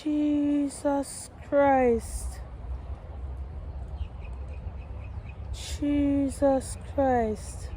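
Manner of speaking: slow, drawn-out, soft disbelief; stretched, hushed dismay at ruined plant; exasperated groan. Real, not acted. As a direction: drag it out in dismay. Finds chickens destroyed her plant.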